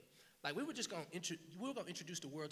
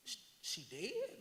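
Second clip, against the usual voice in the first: retold shock; 6 semitones higher, slower than usual. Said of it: "And so I said".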